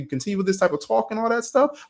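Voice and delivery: high-pitched retold warning; retold ultimatum. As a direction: warn him, pitch jumping up. Retold ultimatum to rude customer.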